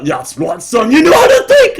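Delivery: rising to very high, loud laughing dad; proud father. Acted. Father praising son, climbing high and loud. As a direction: cheer your boy on.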